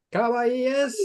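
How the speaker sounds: high excited chant; excited anime fan. Real, not acted. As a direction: call it out like a fan. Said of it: "shall I do the countdown".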